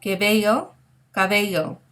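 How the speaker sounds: the teacher shows students how close "cabello" sounds to "bello"; contrasting qué bello and cabello. Shown as a demonstration. Teacher contrasts "Qué bello" and "cabello" for students. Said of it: "Do you hear how similar that is to cabello".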